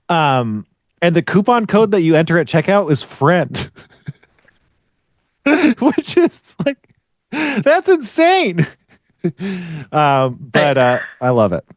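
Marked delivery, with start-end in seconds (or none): 5.8-8.7 high, incredulous voice through laughter; laughing disbelief. Real, not acted. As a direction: crack up as you say it, drawing out the last word. Cracking up at the coupon code.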